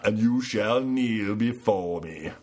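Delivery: slow, grand tyrant command; booming villain voice. Pretend. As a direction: demand they kneel, like a tyrant. Acting as clan leader Zod.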